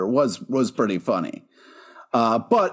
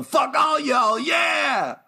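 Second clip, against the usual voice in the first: slow, high mock cheer; mock shout; 10 semitones higher, much slower than usual. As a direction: yell it like a rowdy fan.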